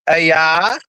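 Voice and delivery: A I said low and flat. Shown as a demonstration. Streamer contrasting fake and real photos, low on A I. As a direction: drop low on A I, like a verdict. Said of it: "let's look at the difference".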